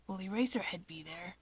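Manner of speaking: soft unvoiced whisper, curious; whispery Shigaraki. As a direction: breathe the question quietly. Shigaraki eager question.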